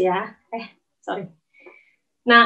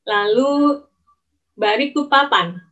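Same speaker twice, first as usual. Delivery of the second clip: ordinary talk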